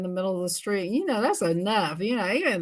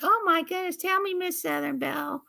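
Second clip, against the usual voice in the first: lightly raised puppet voice; a hint of the gossipy puppet; Minnie the puppet; 8 semitones higher than usual. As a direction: puppet begging to hear the gossip. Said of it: "She's like".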